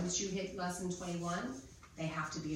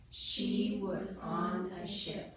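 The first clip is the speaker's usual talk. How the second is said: very slow, louder, one word at a time; pounded-out sentence; 6 dB louder, much slower than usual. Shown as a demonstration. Teacher dictates a sentence to pound out, slow and separated. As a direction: stretch each word out firmly for pupils. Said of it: "We're gonna pound it out".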